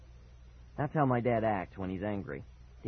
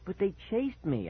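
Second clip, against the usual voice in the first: high-pitched protest; worked up; 8 semitones higher than usual. A narrator's reading. Boy insisting to dad dogs chased him, worked up. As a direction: push back with rising agitation. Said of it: "getting all worked up again".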